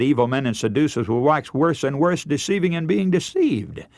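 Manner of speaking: usual speaking voice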